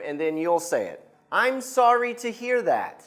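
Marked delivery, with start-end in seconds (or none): 1.4-3.0 higher model line for repeating; teacher modelling a reply again. Shown as a demonstration. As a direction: say it clearly for the class. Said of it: "I'll say it one more time".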